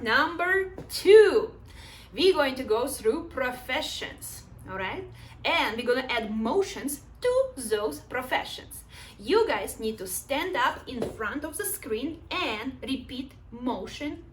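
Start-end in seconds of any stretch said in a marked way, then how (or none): none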